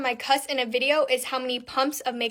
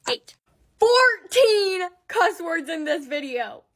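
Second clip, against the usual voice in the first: slow, higher, louder mock sob over the total; mock crying; 5 semitones higher, 4 dB louder than usual. Vlogger fake-crying over fourteen cuss words. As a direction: wail the number like it's a tragedy.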